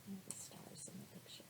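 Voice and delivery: hushed whisper; softly whispered aside. Real, not acted. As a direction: lean in and whisper. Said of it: "whispered aside".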